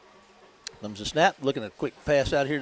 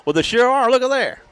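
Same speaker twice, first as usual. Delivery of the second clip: high, loud astonished agreement; excited reaction; 9 semitones higher, 8 dB louder than usual. Real, not acted. Announcer agrees with co-host about fourth-down attempt, excited. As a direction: exclaim at what you see on the field.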